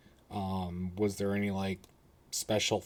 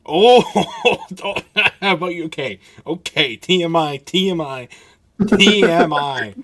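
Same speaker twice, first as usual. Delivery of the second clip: loud, high, laughing protest; real scandalized laughter, pitched up; laughing shock; 7 semitones higher, 11 dB louder than usual. Real, not acted. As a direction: cry out through laughter, louder and higher. Laughing shock at too much information.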